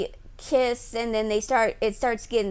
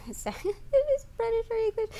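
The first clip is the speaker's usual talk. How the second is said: laughing; 9 semitones higher, 5 dB quieter, faster than usual. Real, not acted. Laughs at own confusion.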